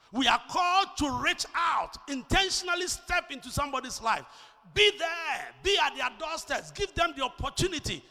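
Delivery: loud, high pulpit exhortation; emphatic, shouted preaching; full-force preaching. Real, not acted.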